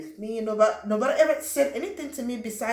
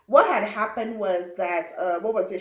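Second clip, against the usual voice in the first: ordinary talk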